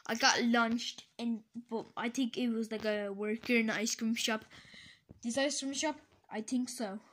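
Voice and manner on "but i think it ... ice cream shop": ordinary talk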